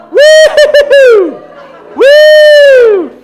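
very high, loud whoop; long ringing woo-hoo of joy; joyful whoop. Real, not acted. Preacher stirring up joy before the Lord, ecstatic whoop.

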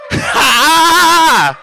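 high "haaa" held long; loud laugh-holler. Real, not acted. Preacher laughing at the devil, long loud laugh.

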